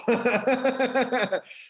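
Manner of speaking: real burst of high laughter. Real, not acted. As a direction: laugh out at the memory. Laughing at John's antics.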